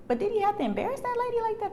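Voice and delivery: high, fast protesting question; incredulous challenge. Retold or imitated. Pushing back at church people, incredulous high challenge. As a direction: press the question in a high, quick voice.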